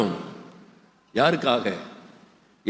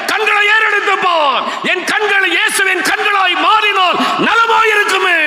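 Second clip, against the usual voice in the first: impassioned preaching shout; 13 semitones higher, 4 dB louder than usual. Real, not acted. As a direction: shout the call to lift your eyes. Climactic call to the church. (உச்சக்கட்ட உணர்ச்சிப் பிரசங்க முழக்கம்)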